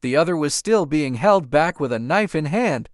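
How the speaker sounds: ordinary delivery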